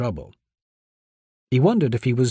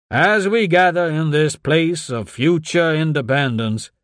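pompous senator oratory; 6 semitones higher, 3 dB louder than usual. A narrator's reading. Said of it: "voice continued to roar through the microphone".

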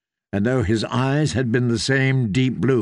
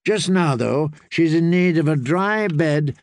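reassuring character voice, pitched up a little; kindly septon; 4 semitones higher than usual. Acted. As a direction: put the lad at ease with a memory. Septon answers for Brienne.

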